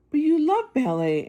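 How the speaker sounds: can't understand why she would quit; puzzled. A narrator's reading. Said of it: "sounding puzzled".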